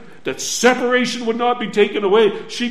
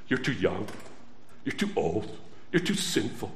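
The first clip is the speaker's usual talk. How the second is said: low whispered doubts; 11 semitones lower, 11 dB quieter, faster than usual. Acted. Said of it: "a little voice speaking in your heart".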